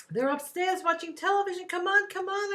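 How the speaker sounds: high, loud and eager; urging him on, thrilled; excited child. Retold or imitated. Child excitedly greeting returning uncle.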